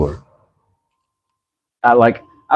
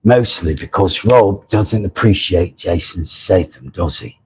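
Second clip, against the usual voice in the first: mock British accent; 2 semitones lower, 3 dB quieter than usual. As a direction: put on a rough English accent. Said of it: "in his accent".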